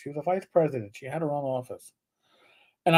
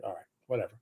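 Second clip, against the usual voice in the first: dismissive; 8 semitones lower, 12 dB quieter, much faster than usual. Retold or imitated. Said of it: "I was like".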